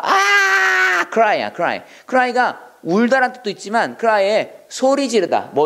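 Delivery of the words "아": sudden high yell of "아!"; short piercing shout; demo shout. Shown as a demonstration. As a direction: cry out for help in one burst. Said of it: "도움을 구하려고 소리를 질렀습니다".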